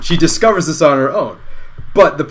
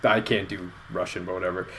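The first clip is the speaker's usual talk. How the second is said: normal talking voice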